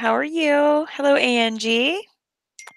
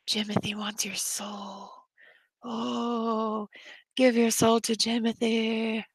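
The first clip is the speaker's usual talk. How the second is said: eerie whispered voice for a creepy doll; spooky whisper; 2 semitones higher, 6 dB quieter, slower than usual. Pretend. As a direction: hiss it like a haunted toy. Voicing the mod as spooky.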